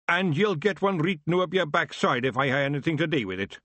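Scottish pictsie snarling a warning; light Scottish bluster; Scots growl. Acted. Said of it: "Big Yan growled".

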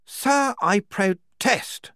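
slow, high, loud objection; protesting major. Acted. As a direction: protest with drawn-out emphasis.

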